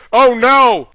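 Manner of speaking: high, loud cry of alarm. Acted. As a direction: gasp it in alarm. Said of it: "said Indian Jones".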